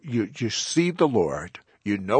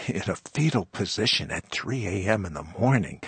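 ordinary talk, nothing marked

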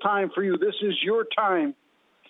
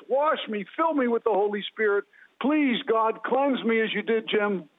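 higher, pleading prayer voice; fervent prayer; 4 semitones higher than usual. Shown as a demonstration. Leading listeners in a prayer for cleansing, fervently pleading. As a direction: cry out to God, urgent and earnest. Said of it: "say this prayer".

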